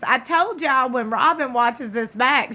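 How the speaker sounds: ordinary talk, nothing marked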